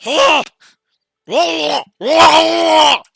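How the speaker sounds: high loud guttural creature grunts; lizard folk grunts. Acted. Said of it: "and they're like".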